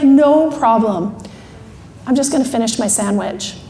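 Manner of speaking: leisurely, unhurried. Retold or imitated. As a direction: imitate someone in no hurry at all. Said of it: "she's one of the slowest people".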